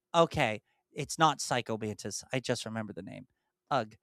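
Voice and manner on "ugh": ordinary talk, nothing marked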